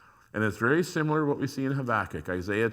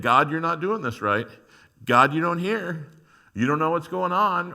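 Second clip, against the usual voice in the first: louder, higher indignant complaint; accusing God; 6 semitones higher, 8 dB louder than usual. Acted. Worshipper accusing God of not hearing, indignant and louder. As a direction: scold God for not listening.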